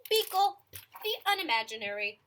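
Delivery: loud, deliberate picture-book title reading; story title announcement. Acted. Reader introducing a picture book to children, announcing. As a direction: announce the book with big, separated words.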